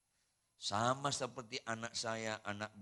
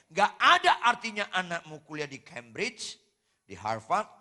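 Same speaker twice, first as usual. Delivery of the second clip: loud warning; 5 semitones higher, 14 dB louder than usual. Retold or imitated. Pastor warns his congregation.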